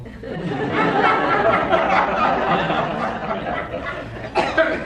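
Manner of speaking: a long breathy laugh, rising. Real, not acted. Monk telling the donkey story, laughing warmly.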